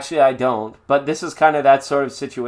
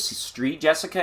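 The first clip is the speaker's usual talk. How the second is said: ordinary delivery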